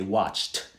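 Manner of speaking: WATCHED as one syllable ending ch-t; correct t ending. Shown as a demonstration. Teacher showing what we say, t ending. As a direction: finish WATCHED on a crisp t. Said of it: "Sounds like a T on the end".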